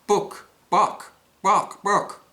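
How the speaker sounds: high, loud clucking book book; mock chicken clucking the word book. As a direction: squawk it like a chicken. Chicken speaking in joke.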